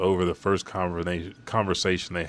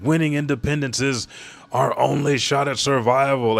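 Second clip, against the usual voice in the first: raised, higher rallying shout; rallying battle cry; 7 semitones higher, 4 dB louder than usual. Retold or imitated. Guards rally the convoy before they're shot, shouting. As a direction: call it out to keep the troops fighting.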